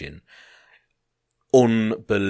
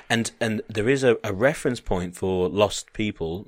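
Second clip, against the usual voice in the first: ordinary talk, nothing marked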